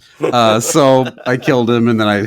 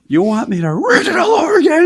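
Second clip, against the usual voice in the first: put-on high, loud parody of the angry old man; mock scream; 4 dB louder than usual. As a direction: scream it at the psychiatrist. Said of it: "screaming at his psychiatrist".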